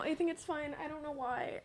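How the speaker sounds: very quiet, high upset voice; real tearful softness; near tears. Real, not acted. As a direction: barely get it out, close to crying. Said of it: "I feel like I'm gonna cry".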